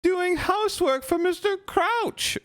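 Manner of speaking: very high, offended elf squeak with a sob; tipsy house-elf defending her master, indignant. Acted. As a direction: squeak it out, wounded pride. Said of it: "Winky squeaked indignantly".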